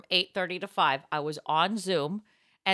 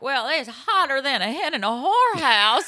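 mock Southern saying; 5 semitones higher, 5 dB louder than usual. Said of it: "so Blanche Devereaux".

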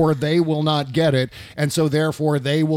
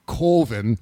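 put-on Minnesota accent; 5 semitones lower than usual. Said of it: "Whenever I hear them say Coven".

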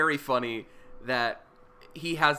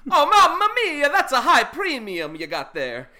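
much higher, louder mamma mia, laughing; Italian accent; 13 semitones higher, 10 dB louder than usual. Pretend. Host imagines Italian insurance salesman, laughing Italian accent. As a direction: burst into a hammed-up Italian salesman. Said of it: "he's like".

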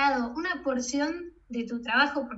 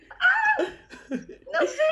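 nervous laugh; 14 semitones higher, 3 dB louder, much slower than usual. Retold or imitated. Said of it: "y yo, "Ok".

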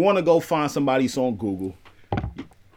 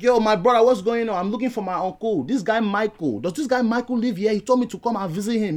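imitated Nigerian nephew, pitched up; put-on Nigerian accent; 4 semitones higher than usual. Visitor asks neighbour's son for his uncle, put-on Nigerian accent. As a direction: plead your case in a Nigerian accent. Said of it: "a Nigerian accent says".

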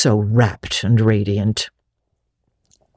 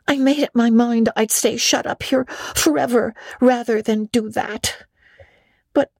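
put-on higher girlish voice, sorry then softening; a girl admitting her temper; young Anne confessing; 10 semitones higher than usual. A narrator's reading. Anne's long confession.

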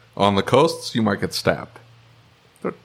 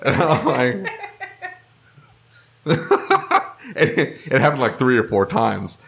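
ordinary talk, nothing marked

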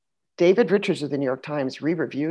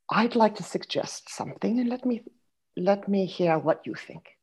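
soft, higher, measured stage-lady voice; Rosemary Harris; 4 semitones higher, 5 dB quieter than usual. Retold or imitated. Veteran actress gently offering her castmate a suggestion, soft and measured. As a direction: imitate a tactful veteran actress, softly and carefully. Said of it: "Rosemary Harris came into it then too".

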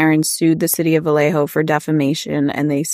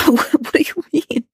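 high, slow laugh through the words; laughing disbelief; 10 semitones higher than usual. Real, not acted. Incredulous laughing outburst.

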